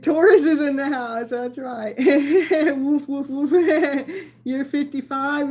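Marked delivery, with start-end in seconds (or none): 2.0-4.1 mock barking. Laughing barks for Taurus.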